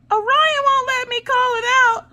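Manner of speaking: put-on very high, squeaky tattling child; high little-girl complaint; whiny kid voice. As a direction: pitch way up like a kid telling on her brother. Voicing daughter Maggie complaining.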